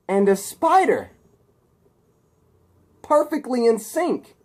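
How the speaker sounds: high, slow, fervent declaration; crazed villain. Acted. Harry telling Peter he is his father's creation, crazed declaration. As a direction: marvel loudly at your prize specimen. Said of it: "Harry is crazy".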